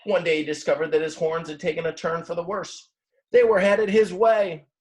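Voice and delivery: the narrator builds alarm as the horns turn toward the sheep; dramatic reveal. A narrator's reading.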